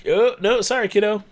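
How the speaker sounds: high, loud mock apology to a child; mock handler to a kid. Handler brushing off a kid, high and bright. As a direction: play the handler shooing a kid off, sing-song.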